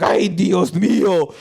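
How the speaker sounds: slow, hammed-up Spanish gasp of dismay; mock Spanish exclamation. Villagers reacting to the possessed boy, in a hammed-up Spanish accent. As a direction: draw out a mock Spanish cry of alarm. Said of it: "I think that was Spanish".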